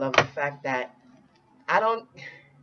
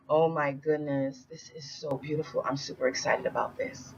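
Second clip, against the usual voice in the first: ordinary delivery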